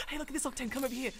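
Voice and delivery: ordinary delivery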